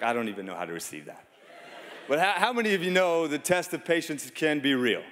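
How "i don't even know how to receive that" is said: low, quick, softer retold reaction; baffled aside. Coach reacting to his wife's backhanded compliment, baffled aside. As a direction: drop it low and toss it away, nonplussed.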